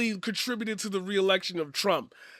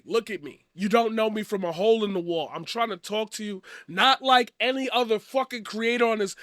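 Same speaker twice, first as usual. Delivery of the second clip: ordinary talk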